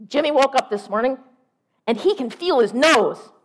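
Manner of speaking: high, very loud excited news; hollered phone announcement; yelling. Acted. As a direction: shout it out as the loud sister. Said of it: "she was yelling".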